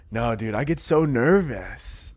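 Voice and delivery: normal talking voice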